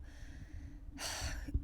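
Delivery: long unvoiced sigh out; weary sigh; heavy sigh. Real, not acted. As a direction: let the breath go heavily before going on. Pause before telling bad news.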